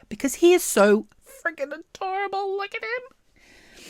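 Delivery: high-pitched excited squeal; squealing over the pig. Real, not acted. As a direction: gush like you've seen the cutest thing. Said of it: "Sorry, I'm, like, really excited".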